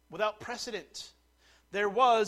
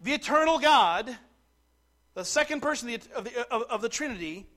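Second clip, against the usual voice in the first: loud, raised-pitch proclamation; emphatic preaching; 5 semitones higher, 7 dB louder than usual. Real, not acted. Preacher to congregation on God clothed in flesh, emphatic preaching. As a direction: drive the doctrine home with full voice.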